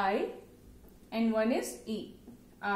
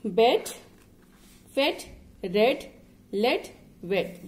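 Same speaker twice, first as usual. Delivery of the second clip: each word alone, louder and emphatic; read-along drill; 7 dB louder, slower than usual. Shown as a demonstration. Teacher reading a word list with a child, loud and emphatic. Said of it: "just read it with me".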